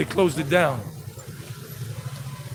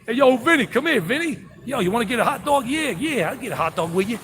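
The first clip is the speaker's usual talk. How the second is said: put-on higher-pitched, animated New York accent; 6 semitones higher than usual. Calling Vinny over for a hot dog, New York accent. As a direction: call a pal over in New Yorkese. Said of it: "That's my New York City accent".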